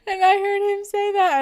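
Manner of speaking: crying with laughter; laughing through tears. Real, not acted. To the chat, hearing the catchphrase again, crying with laughter.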